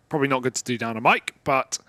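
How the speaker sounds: usual speaking voice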